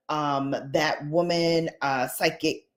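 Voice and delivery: ordinary talk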